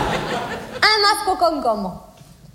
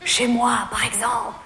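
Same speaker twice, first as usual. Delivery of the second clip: low, deep mock male voice; Jean-Loup's voice; 7 semitones lower, 4 dB quieter than usual. Jean-Loup wrongly thinks she likes it, in a low mock-male voice. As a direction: purr it like a smug suitor.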